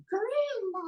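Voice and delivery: put-on high, slow babyish "Grandma"; baby puppet voice. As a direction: call for grandma like a small baby.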